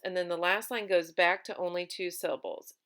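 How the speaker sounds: normal talking voice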